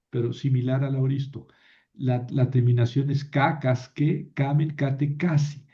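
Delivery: usual speaking voice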